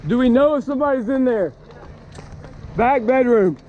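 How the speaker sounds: high, urgent shouting over noise. Real, not acted. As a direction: call out urgently to the homeowner. Calling homeowner at burning house.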